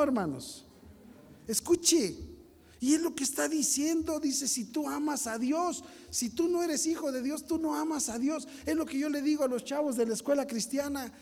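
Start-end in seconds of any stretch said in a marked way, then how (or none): 1.5-9.6 high, raised pulpit voice; impassioned sermon delivery; fervent preaching. Acted. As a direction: preach it out to the congregation.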